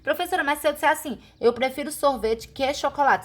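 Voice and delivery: a student raising a hand in class; student question. Retold or imitated. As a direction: ask it like a curious student. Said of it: "alguém ia perguntar".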